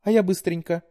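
put-on high, pleading young woman; daughter voice. A narrator's reading. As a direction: coax like a sweet daughter. Daughter voice held.

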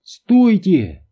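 high, urgent cry; shouted command. A narrator's reading. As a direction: cry out to stop them. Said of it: "закричала Рут". (тревожный окрик высоким голосом)

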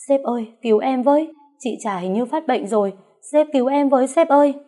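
high, loud, panicked wail; crying for help. Acted. The mistress wails to the boss to save her, high and loud. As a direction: shriek for rescue, playing the victim.

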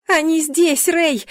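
high, loud cry of alarm; shouting. A narrator's reading. Heroine warns her guard mid-fight, shouting. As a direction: shout a warning at the top of the voice. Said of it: "крикнула я".

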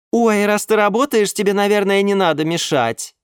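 put-on high, cooing young woman; sweet girlfriend voice. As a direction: coo it to your sugar daddy. Nymph voiced on the phone. (воркующая блондинка)

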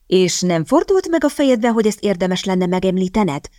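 plain reading voice

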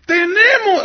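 high, loud mocking announcement; sarcastic mockery. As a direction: ridicule him in front of everyone. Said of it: "así burlándose sarcásticamente".

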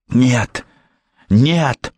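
rising frantic "no"; frantic denial. A narrator's reading. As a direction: cry out in panic. Denying accusation.

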